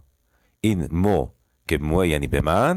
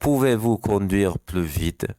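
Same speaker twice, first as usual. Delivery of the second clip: slow repeat; 2 semitones higher, much slower than usual. Shown as a demonstration.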